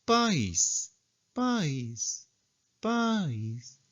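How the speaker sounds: "País" started high, then falling, softly; circumflex accent. Shown as a demonstration. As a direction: slide "País" down from a fifth to a third. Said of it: "empieza en una quinta y baja una tercera".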